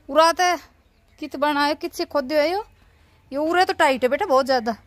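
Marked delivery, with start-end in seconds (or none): none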